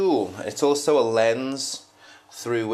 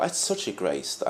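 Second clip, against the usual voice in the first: normal talking voice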